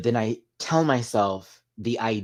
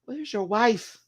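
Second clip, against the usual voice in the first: mock disbelief; 12 semitones higher than usual. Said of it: "and I was like".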